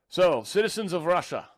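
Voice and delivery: a brief, hammed-up Russian accent for the opening address; Putin Russian accent. Vlogger reading a Russian leader's address, light mock Russian accent. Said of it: "I'm not gonna try to do it all in a Russian accent".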